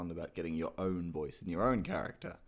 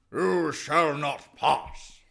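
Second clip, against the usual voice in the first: loud, very slow wizard's yell; breathy, strained bellow from the chest; high, drawn-out command in an old British wizard's voice; Gandalf; 8 semitones higher, 10 dB louder, much slower than usual. Shown as a demonstration. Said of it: "when he's yelling".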